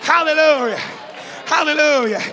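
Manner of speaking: loud, driving sermon cadence; fiery preaching. Acted. Preacher rousing the church at full volume.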